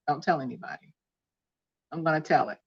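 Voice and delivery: hushed plea to viewers to keep a secret. Real, not acted.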